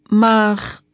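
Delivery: loud protesting but. Acted. Girl protesting to the policeman, loud. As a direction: cut in to object. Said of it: "protesteerde Fien".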